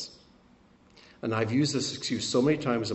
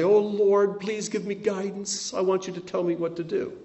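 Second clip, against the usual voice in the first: pitched-up, pleading "please tell me" prayer; mock pleading prayer; 9 semitones higher than usual. Preacher mocking a believer who asks God what to do, in a higher whiny plea. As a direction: plead with God in a hammed-up helpless voice.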